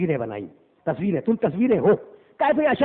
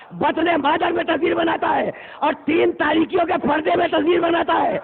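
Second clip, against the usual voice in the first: rising sermon crescendo; full-force preaching; 8 semitones higher than usual. Acted. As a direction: drive each line home to the gathering.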